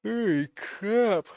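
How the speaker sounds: words stretched through a big yawn. Real, not acted. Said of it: "I keep yawning on you".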